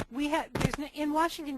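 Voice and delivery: tripping over the words, stammering; stumbling and restarting, stammering. Real, not acted. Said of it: "sorry about the stammering".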